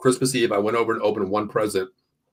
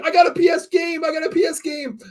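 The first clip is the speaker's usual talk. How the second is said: put-on excited kid shout. Retold kid on Christmas Eve.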